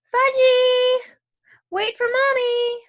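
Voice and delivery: high, loud, drawn-out call; mother calling out loudly in a high voice; hollering mother. A narrator's reading.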